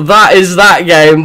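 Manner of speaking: normal talking voice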